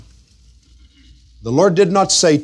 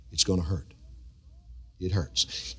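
hushed, softer whispered warning; 6 semitones lower, 15 dB quieter than usual. Acted. Pastor warning believers about pain, whispered. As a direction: lean in and breathe the words.

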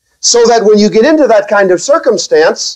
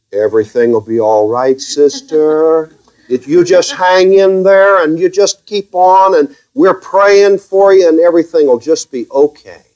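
sarcastic comforting. Acted. Typical pastor consoling a church sister, sarcastically. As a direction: soothe with syrupy, stretched-out sarcasm. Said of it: "Now, I say that sarcastically".

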